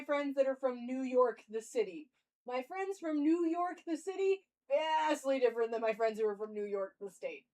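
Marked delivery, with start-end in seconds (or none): none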